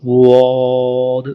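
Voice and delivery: वाद slow and heavy; Arabic letter. Shown as a demonstration. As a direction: drag वाद out. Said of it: "अगला जो तलफ़ुज़ है". (वाद खींचकर)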